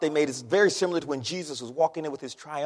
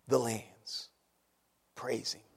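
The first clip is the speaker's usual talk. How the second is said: whispered psalm line; 6 semitones lower, 9 dB quieter, much slower than usual. Acted. Quoting the psalm, fading out.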